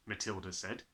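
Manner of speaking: usual speaking voice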